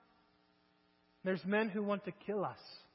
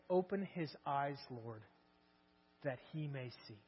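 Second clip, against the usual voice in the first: much softer, lower, reverent prayer; hushed prayer; 7 semitones lower, 11 dB quieter than usual. Acted. Elisha praying for his servant's eyes, hushed and low. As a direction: breathe the prayer almost silently.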